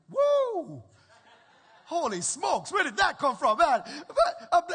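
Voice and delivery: whooping, laughing and loud; excited. Real, not acted. Preacher thrilled by a cheerful child, whooping loud. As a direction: burst out with joy. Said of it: "I'm excited".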